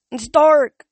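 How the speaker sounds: high, loud terrified yell. A narrator's reading. Chris in the basement yelling in fear of the dark. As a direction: yell it like the dark is swallowing you. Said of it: "Chris yelled".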